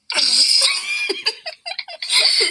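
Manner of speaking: shrieking high laugh; high giggle fit. Real, not acted. A child laughing at her own dun dun dun, squealing. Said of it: "Stop it".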